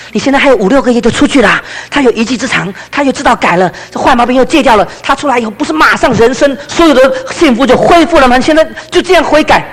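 urgent pleading, pitched high; 急切劝说. Retold or imitated. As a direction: re-enact talking someone out of despair, voice climbing. 急着劝朋友妻子忍耐. (提高声调苦劝对方忍耐)

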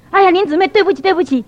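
high, loud flustered apology; acted-out anxious apologizing; nervous apology. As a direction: blurt out excuses, imitating a flustered colleague. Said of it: "她就很紧张了".

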